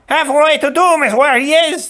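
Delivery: very high, loud sneer; gloating Sicilian. Acted. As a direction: relish his doom. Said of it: "the Sicilian said".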